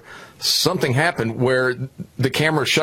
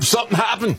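high-pitched stoner dude impression; Spicoli; 10 semitones higher than usual. Host mocks an official's answer as Spicoli, spaced-out dude voice. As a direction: play a clueless surfer slacker offering an excuse. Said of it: "answered like Spicoli".

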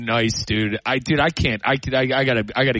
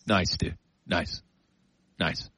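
much lower, much softer, deflated "nice"; sulky nice; 9 semitones lower, 9 dB quieter, slower than usual. Real, not acted. Coworker missed the great lunch, deflated and soft. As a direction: mutter it, disappointed.

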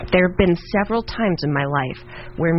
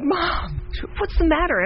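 put-on childlike; 12 semitones higher, slower than usual. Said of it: "I'm like".

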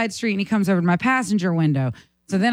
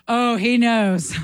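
slow knowing; much slower than usual. Retold or imitated. Realizes the officer knows.

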